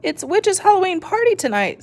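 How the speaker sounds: bright eager exclaim; excited. A narrator's reading. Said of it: "Skeleton exclaimed".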